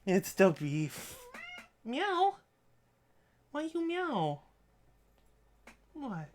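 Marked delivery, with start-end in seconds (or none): 1.8-4.4 much higher cat-mimic coo; mock meow. Real, not acted. Streamer meowing back to her cat, playful coo.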